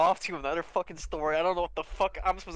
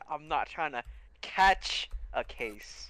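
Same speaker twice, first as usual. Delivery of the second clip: slow correction; much slower than usual. Real, not acted. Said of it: "I said".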